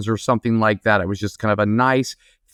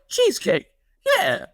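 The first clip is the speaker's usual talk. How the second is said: mock gleeful customer driving home; giddy sing-song. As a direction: croon it to yourself like a happy child. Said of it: "he's just like".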